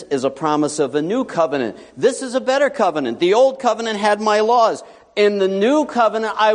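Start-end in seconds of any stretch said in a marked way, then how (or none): none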